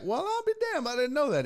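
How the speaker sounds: high, delighted surprise; amazed. Retold or imitated. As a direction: sound it out, pleasantly stunned. Pleasantly amazed.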